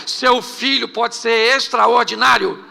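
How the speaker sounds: loud, slow preacher cry to the congregation; full-force preaching. Acted. Pastor rallying the church, loud preaching. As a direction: call it out over the crowd with fervour.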